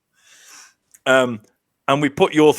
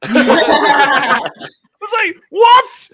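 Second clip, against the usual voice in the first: high, loud, disbelieving what; a loud, squeaky retold what; shocked what; 12 semitones higher, 5 dB louder, much slower than usual. Shocked at engineers' admission.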